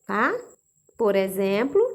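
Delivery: ordinary talk, nothing marked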